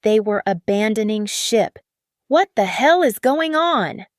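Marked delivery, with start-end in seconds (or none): 2.3-4.1 higher, louder furious roar. Acted. Marcus roaring at executives abandoning his yacht, furious and loud. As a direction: bellow it at the fleeing executives. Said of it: "Marcus was roaring".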